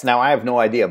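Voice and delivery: ordinary talk, nothing marked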